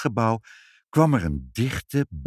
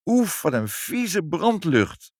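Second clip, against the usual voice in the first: higher, slow mild disgust; 9 semitones higher, slower than usual. Acted. Mother groans at the burnt smell, slow and mildly disgusted. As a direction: wrinkle the nose at the stink.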